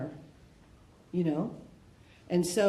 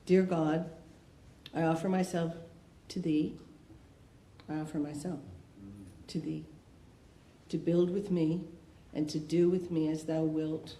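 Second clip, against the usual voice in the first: recited prayer; 2 semitones lower, 3 dB quieter than usual. Acted. Speaker recites the third step prayer to God, measured and reverent. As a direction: pray it evenly, phrase by phrase.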